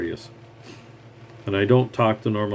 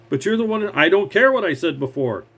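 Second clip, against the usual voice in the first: high-pitched heated cut-in; mock indignant retort; acted quarrel; 7 semitones higher than usual. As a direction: argue over the other person, pitch up. Acted shouting match with boss.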